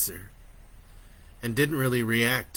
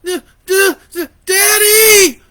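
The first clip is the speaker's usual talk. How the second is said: stammering high crying shout; terrified shout; 15 dB louder than usual. A narrator's reading. Boy fleeing his mother screaming for his father. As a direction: stutter then scream for daddy. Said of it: "he shouted as he backed away".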